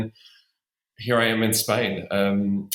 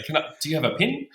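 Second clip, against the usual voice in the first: higher, light Kiwi voice saying "pin"; mock Kiwi accent; 8 semitones higher, faster than usual. Australian imitating a Kiwi asking for a pen, higher. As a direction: pitch it up and squeeze pen into "pin". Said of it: "Every time someone would say".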